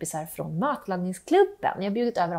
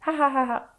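mischievous laugh; 3 semitones higher than usual. Acted. Kids plotting a prank.

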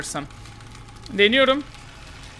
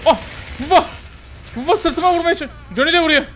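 high excited gamer shout; excited yelp; 7 semitones higher, faster than usual. Real, not acted. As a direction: yelp as the killer hits.

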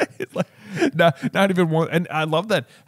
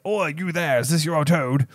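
a hint of Hagrid calling out; lightly voiced gruff giant calling out; bad Hagrid impression; 3 semitones higher than usual. Hagrid calling to the first years, lightly voiced. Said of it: "That was a, such a bad Hagrid".

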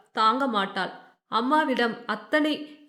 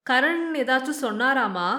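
usual speaking voice

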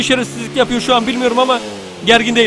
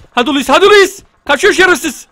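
excited urging; 6 semitones higher, 5 dB louder than usual. Real, not acted. Urging the character on mid-chase.

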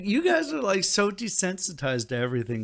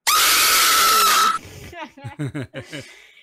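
scary cackle; 7 dB louder than usual. Pretend. Jump scare on stranger.

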